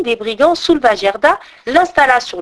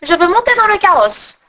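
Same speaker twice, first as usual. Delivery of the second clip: légèrement voix d'enfant effrontée; petite brigande capricieuse; 8 semitones higher, 4 dB louder than usual. Acted. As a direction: une gamine autoritaire, à peine jouée. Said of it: "Et elle ajouta". (fille des brigands exigeant Gerda, ton capricieux léger)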